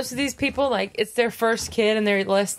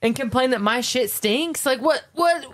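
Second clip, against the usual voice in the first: aggressive mock rant; 3 semitones higher than usual. Parent scolding a kid who follows them into the bathroom, increasingly aggressive. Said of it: "I'm sorry if that seemed aggressive".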